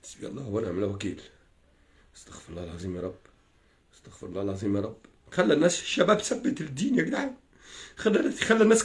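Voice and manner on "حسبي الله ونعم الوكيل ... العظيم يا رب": much softer and lower, fast under-breath mumbling; muttered prayer. Real, not acted. Asking God's forgiveness after the curses, muttered fast and low.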